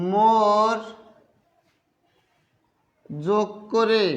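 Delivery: slow, drawn-out words for note-taking; slow dictation. Real, not acted. Teacher dictating the comparative rule to students, slow dictation. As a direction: dictate word by word with long pauses.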